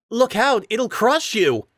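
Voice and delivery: high, loud cry of alarm; urgent warning shout. A narrator's reading. Warning rider about the statue, loud high cry. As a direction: yell the warning.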